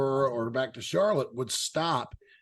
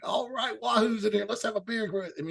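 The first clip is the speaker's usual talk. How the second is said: higher-pitched imitation of welcoming bar patrons; friendly greeting; 7 semitones higher than usual. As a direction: lightly hail a drinking buddy, pitched up.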